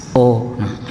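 normal talking voice

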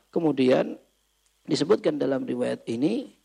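ordinary delivery

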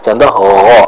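ordinary talk, nothing marked

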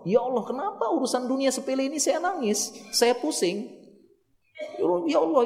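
high-pitched lamenting prayer to God; acted tearful plea. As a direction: cry out to God in distress, performed. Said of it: "pertama kali nangis sama Allah".

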